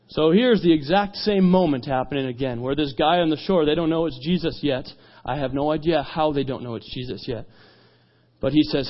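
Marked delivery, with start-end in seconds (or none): none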